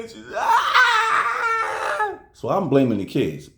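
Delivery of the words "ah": very high mock wail of a crying girl; imitated crying wail. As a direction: let out a high sobbing scream like the niece. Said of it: "she's crying".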